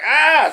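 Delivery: sudden high yelp of fear; scared yelp. Retold or imitated. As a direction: yelp high like kids scared of falling branches. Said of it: "They were like".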